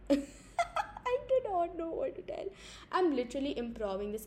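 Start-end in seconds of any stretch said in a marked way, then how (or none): 0.0-2.5 high, slow words through laughter; laughing. Real, not acted. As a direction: talk while laughing.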